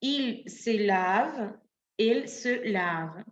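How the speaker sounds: each form spoken slowly and clearly; walk through the forms slowly; slow drill. Shown as a demonstration. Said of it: "Nous nous lavons". (conjugación lenta y clara)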